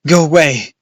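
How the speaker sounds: loud, high yell; shouted. A narrator's reading. Boy yells at bats in the barn, loud.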